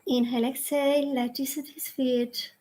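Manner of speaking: hushed, unhurried devotional guidance; guided prayer. Real, not acted. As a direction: settle the group gently before closing. Leads into closing prayer.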